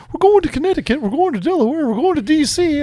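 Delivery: high, eager mock listing of road trips; imitating Connor. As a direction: pitch it up like an excited friend planning trips. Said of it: "he's like".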